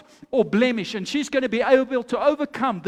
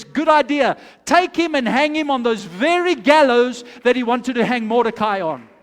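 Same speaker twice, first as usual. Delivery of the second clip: loud, emphatic royal order; commanding king; 7 dB louder than usual. Acted. King orders the plotter hanged on his own gallows, loudly commanding. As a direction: pronounce the sentence with full force. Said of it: "And the king says".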